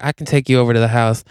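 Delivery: put-on quick Texan cowboy accent; Texas twang. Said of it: "he's got a Texas twang".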